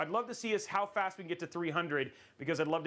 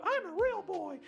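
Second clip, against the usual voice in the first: high squeaky puppet line; Pinocchio squeak; 12 semitones higher than usual. Pretend. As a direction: squeak it like a wooden puppet boy. Quoting Pinocchio line as Tom.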